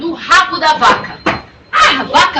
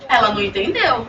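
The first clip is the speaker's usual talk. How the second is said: plain reading voice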